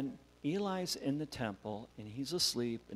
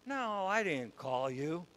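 drawn-out, higher denial; a hint of a pestered priest, slow and raised; Samuel; 6 semitones higher, slower than usual. Priest woken again by the boy, lightly acted slow denial.

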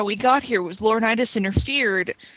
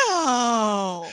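long drawn-out mock wail; imitated cry of protest; retold cry of no. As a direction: stretch it out in dismay. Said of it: "all I could say was".

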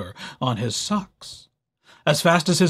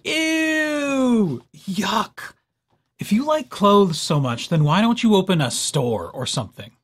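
sarcastic; 4 semitones higher than usual. A narrator's reading. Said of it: "Naked mole rats can be very sarcastic".

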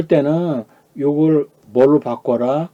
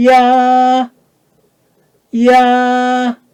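야 held high, level and loud; high first tone; 12 semitones higher, 7 dB louder, much slower than usual. Shown as a demonstration. Teacher modelling first tone for students, 야 high and level. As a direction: sustain 야 on one high note. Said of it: "일성 높게 잡".

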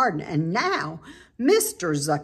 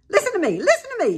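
high, loud goose scream; frantic high goose shriek; 13 semitones higher, 6 dB louder, much faster than usual. A narrator's reading. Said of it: "screamed the goose".

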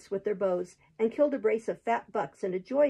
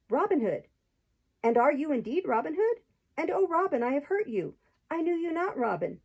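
higher, urgent and upset; dismayed excitement; 6 semitones higher than usual. A narrator's reading. Marian realises she hurt Robin, dismayed and urgent. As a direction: spill it out in dismay.

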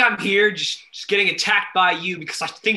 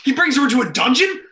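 fast, high, loud question; alarmed disbelief; 6 semitones higher, 5 dB louder, faster than usual. Acted. Boyfriend misunderstanding what a dungeon master is, alarmed. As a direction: blurt it out, voice leaping in shock.

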